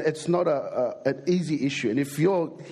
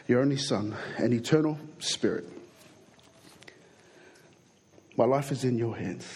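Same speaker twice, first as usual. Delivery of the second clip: hushed, lower prayer voice; 5 semitones lower than usual. Acted. Pastor praying before the sermon, hushed and lower.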